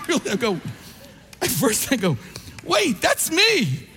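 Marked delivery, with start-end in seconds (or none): 2.6-3.9 surprised realization. Retold or imitated. Overhearing strangers talk about him.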